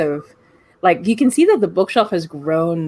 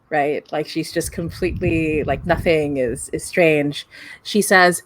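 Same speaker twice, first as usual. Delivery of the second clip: normal talking voice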